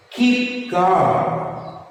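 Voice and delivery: "Keep" pitched high, then falling on "calm"; sentence stress demo. Shown as a demonstration. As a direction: punch "Keep" as a stress model. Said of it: "where you have to stress".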